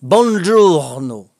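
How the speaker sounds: slow Buongiorno, the R said clearly; drawn-out Buongiorno, sounding the R; Italian buongiorno. Shown as a demonstration. Repeating the assistant's Italian greeting, slow and careful. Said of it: "on entend bien le R".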